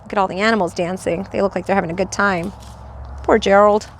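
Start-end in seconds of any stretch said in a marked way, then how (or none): none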